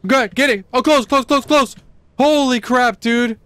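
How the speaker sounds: high-pitched, urgent gamer outburst; real panicked excitement. Real, not acted. Player reacting to a very close call, panicked excitement. Near miss in tense game.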